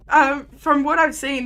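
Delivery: ordinary talk, nothing marked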